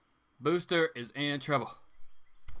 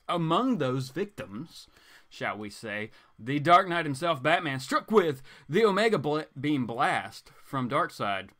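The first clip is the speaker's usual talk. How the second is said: booming trailer-narrator voice, louder; dramatic blurb read; 5 dB louder than usual. Acted. Comic vlogger reads the back cover as a dramatic trailer.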